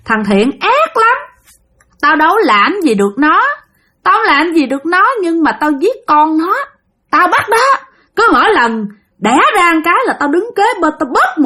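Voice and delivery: high-pitched, vengeful woman's spirit; a dead wife's shrill, high-pitched curse; piercing ghost voice. Acted. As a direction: wail the grudge high and ringing. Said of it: "nói lanh lảnh luôn".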